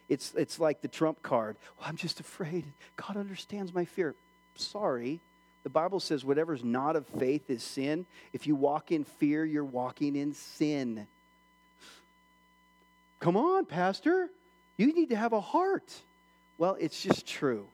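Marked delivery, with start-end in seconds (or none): 1.8-4.1 soft, whispery mock-timid voice; timid excuse. Believer excusing themselves to the pastor, timid whisper. 13.2-16.0 high-pitched mock protest; protesting congregant. Congregant objecting to the pastor, high pleading protest.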